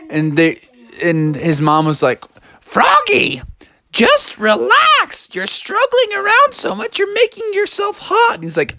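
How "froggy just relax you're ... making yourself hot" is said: very high falsetto mother; scolding mom. Acted.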